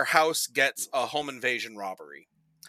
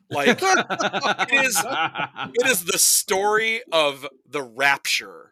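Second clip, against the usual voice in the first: drawn out, high and slow; milk the big reveal slowly; mock-ominous slow build; slow dramatic reveal; 5 semitones higher, 4 dB louder, slower than usual. Dramatic reveal of movie plot.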